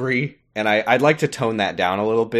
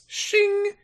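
sword shing noise. Retold or imitated. Sword-drawing sound in quoted scene.

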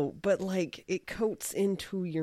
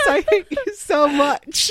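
laughing mock hate; 10 semitones higher, 8 dB louder than usual. Laughing mock outrage at friend.

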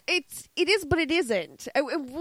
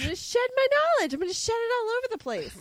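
higher, fast excited babble; giddy rush; 9 semitones higher, much faster than usual. Acted. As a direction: rattle it off in giddy glee. Silly knowledge rant.

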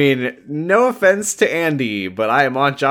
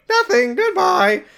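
very high squeaky robot reply; high chirpy AI voice; slower than usual. Retold or imitated. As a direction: brush him off in a squeak. Hollandaise very high.

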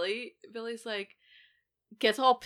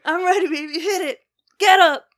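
much higher and louder, pumped up; hyped-up Stu; 10 semitones higher, 12 dB louder than usual. Retold or imitated. Stu psyching himself up before being stabbed, loud. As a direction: pump yourself up, shouting. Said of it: "I love, like, his".

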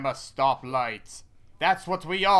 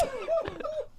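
high squeal laugh; 6 dB quieter than usual. Real, not acted.

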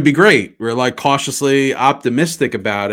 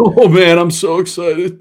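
loud burst easing into drawn-out eagerness; hammed-up thrill, moderately raised pitch; dramatic mock excitement; 4 semitones higher, 5 dB louder, slower than usual. Co-host replaying the hired coach's reaction, slow mock excitement. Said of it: "They did a dramatic reenactment".

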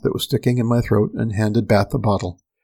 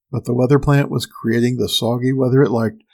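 plain reading voice

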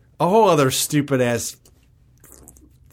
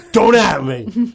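mocking voice; 4 semitones higher, 3 dB louder, much slower than usual. Mocking a friend's phrase.

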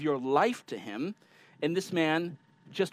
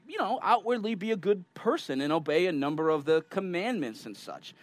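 ordinary talk

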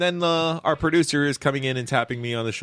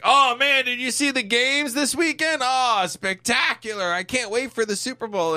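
high-pitched mock gushing; hammed-up, high excited impression; excited fan; 10 semitones higher than usual. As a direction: imitate a fan raving about the games. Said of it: "he's like".

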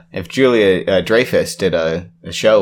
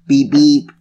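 silly clown; 5 semitones higher, 6 dB louder than usual. Pretend. Silly car noise.

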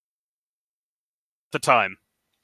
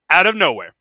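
ordinary delivery

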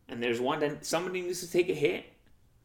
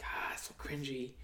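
whispered cringe; 11 dB quieter than usual. Real, not acted. Cringing aside.

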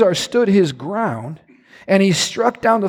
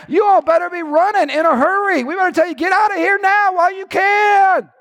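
higher, shouted challenge, building to a yell on the last line; taunting the enemy; 7 semitones higher than usual. Acted. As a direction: jeer at the Philistines, sure of winning. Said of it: "I imagine he went out like this".

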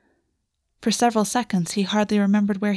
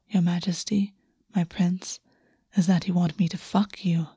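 teasing whisper; 3 dB quieter than usual. Acted. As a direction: coax him with a whisper.

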